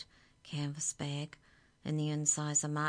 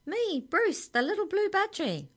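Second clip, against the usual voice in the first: very high, loud little bird voice; squeaky-high character voice; high-pitched budgie; 7 dB louder than usual. A narrator's reading. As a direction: announce yourself as a tiny budgie. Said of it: "there was a high-pitched cry".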